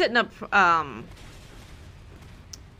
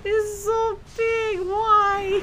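high, slow squeal of disbelief; shocked; 14 semitones higher, 4 dB louder, much slower than usual. Real, not acted. Shocked at huge Pokémon.

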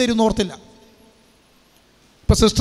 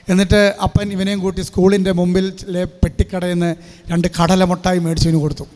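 ordinary talk, nothing marked

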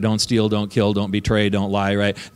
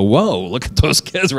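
amazed onlookers; 2 semitones higher, slower than usual. Retold or imitated. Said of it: "and people go".

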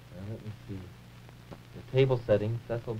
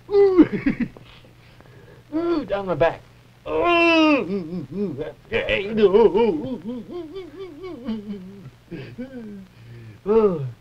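delighted squeals; 3 semitones higher, much slower than usual. Acted. Water down his back.

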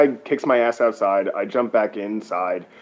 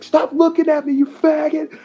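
much higher, hammed-up scream; imitating a screaming bully, pitched way up; mock screaming; 4 dB louder than usual. Imitating the guy screaming on the bus, hammed-up high. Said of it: "he's screaming at me".

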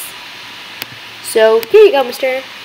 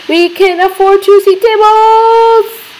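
excited; 10 semitones higher, 6 dB louder, slower than usual. Real, not acted. Thrilled at the game.

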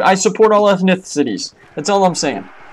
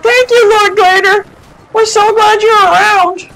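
high, slow, gushing thanks; grateful villager voice; 13 semitones higher than usual. Retold or imitated. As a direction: play a fawning caravan driver. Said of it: "that caravan's like".

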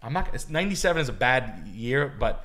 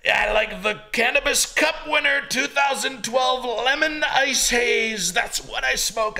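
a much higher, goofy character voice; high put-on voice; 12 semitones higher, 6 dB louder, slower than usual. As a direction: play a bragging stoner character, pitched way up. Long run in high put-on voice.

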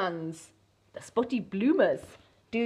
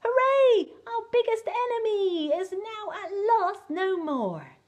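cheering cavemen; 11 semitones higher than usual. Acted. Cavemen celebrating the dinosaurs' end, high excited cheer. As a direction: shout it like you've won. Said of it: "cheered at what they saw".

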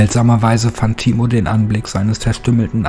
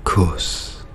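much softer, unvoiced murmur; soft muttering; 2 semitones lower, 6 dB quieter than usual. A narrator's reading. The undead professor babbles softly to himself. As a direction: mumble the word like a mindless zombie. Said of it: "Immer wieder leise".

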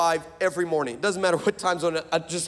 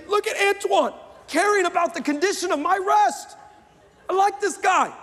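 mock swoon; 11 semitones higher than usual. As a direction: gush like a smitten girl.

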